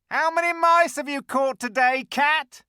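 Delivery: high, loud mocking creature voice; jeering. A narrator's reading. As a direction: sneer at him as a cat. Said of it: "jeering at him".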